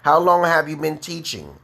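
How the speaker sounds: usual speaking voice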